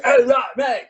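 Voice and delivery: high, garbled British comic impression; mock a British accent, words mashed together. Eager British comic asking host for US work, mock British accent. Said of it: "that was an amazing British accent".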